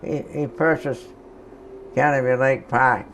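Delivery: ordinary talk, nothing marked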